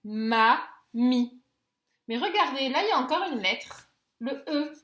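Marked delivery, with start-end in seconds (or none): 0.0-1.4 "Ma, mi" as two separate beats; syllable by syllable. Shown as a demonstration. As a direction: pause between "Ma" and "mi". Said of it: "Donc, on a écrit mi".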